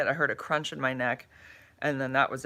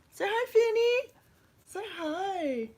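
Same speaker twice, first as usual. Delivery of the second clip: high, cooing pet voice; slower than usual. Real, not acted. Owner coaxing her dog on her lap to greet, high pet talk. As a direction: coo up high at the puppy.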